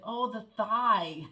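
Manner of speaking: thigh stretched slow and loud; imitating husband's thigh. Husband correcting her on the word thigh, slow loud thigh. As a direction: drag out thigh while slapping the leg, slowly. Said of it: "was like".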